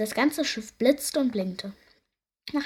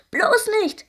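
high, loud cry of dismay; desperate; 10 semitones higher, 8 dB louder than usual. A narrator's reading. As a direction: cut in with desperate alarm. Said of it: "verzweifelt rauften sich die Piraten". (verzweifelt aufgeschrien)

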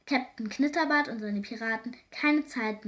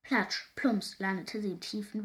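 ordinary delivery

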